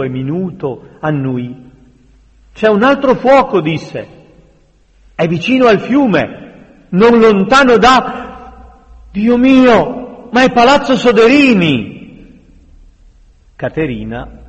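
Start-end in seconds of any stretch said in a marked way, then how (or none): 2.5-3.6 higher, louder cry of alarm. A narrator's reading. Ser Cristofano spots another fire, alarmed. 5.1-11.9 high, loud cry of dawning horror; horrified alarm. A narrator's reading. Man realising Palazzo Soderini is burning, horrified alarm. Horror as own palace burns.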